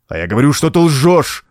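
growled. A narrator's reading. Count overruling the girl, growling. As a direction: growl the accusation. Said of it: "прорычал Генри".

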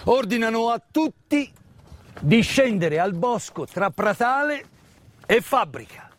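normal talking voice

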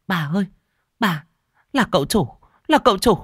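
high-pitched frantic maid calling out; alarmed, high voice; panicked servant. A narrator's reading. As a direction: break terrible news in a high, halting panic. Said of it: "Sen hớt hải chạy ra, mặt cắt không còn giọt máu".

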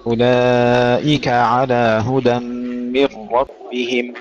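أولئك with the kaf opened fully; slow model, kaf clearly voiced and open. Shown as a demonstration. Teacher shows Jamila the open kaf, slowly. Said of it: "افتحي الكاف جيدًا".

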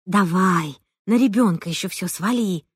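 ordinary delivery

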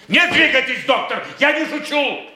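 high, loud warning shout; urgent high-pitched threat. Acted. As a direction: order him to freeze, at full voice. Threatens the doctor, acted standoff.

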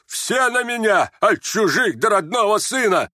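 put-on high-pitched, raging old general; shouting tirade. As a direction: rant at the top of the voice, stressing each insult. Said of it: "кричал генерал". (гневная крикливая тирада)

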